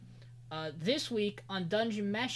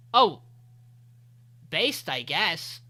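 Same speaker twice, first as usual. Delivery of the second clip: mock impressed shrug; 6 dB louder, slower than usual. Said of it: "Leo's is like".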